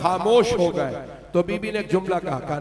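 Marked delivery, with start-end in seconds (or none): none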